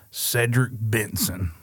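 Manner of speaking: Cedric Benson drawn out slowly; slow, savoured name. Shown as a demonstration. Fan offers an example of a good name, savouring it slowly. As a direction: savour each word of the name, unhurried.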